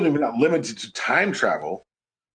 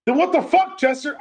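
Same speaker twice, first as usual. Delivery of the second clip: exasperated yell; 10 semitones higher than usual. Acted. Outburst at character.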